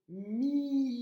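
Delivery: "Mi" fort, le i longuement tenu; syllabe étirée. Shown as a demonstration. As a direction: allonger fortement le i de "Mi". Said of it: "qui fait le son m". (« Mi » étiré, plus aigu et appuyé)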